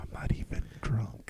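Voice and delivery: unvoiced hushed whisper. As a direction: whisper it, half joking. Keeps up the whisper joke.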